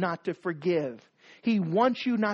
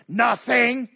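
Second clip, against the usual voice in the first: Nothing. snapped short and louder; a louder, sharper Nothing. that means everything; curt angry nothing; 2 semitones higher, 7 dB louder than usual. Acted. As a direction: bite off Nothing. as a hostile denial. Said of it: "Or it's even worse".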